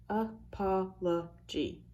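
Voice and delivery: slow, syllable by syllable; clapped syllables. Shown as a demonstration. Teacher counts syllables aloud for the class, slowly. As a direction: stretch the word out so each beat shows. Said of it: "I hear four syllables in that one".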